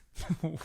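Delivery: a short disbelieving laugh; scoffing burst of laughter; incredulous laugh. Real, not acted. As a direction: laugh out at something absurd. Laughing at guest's claim.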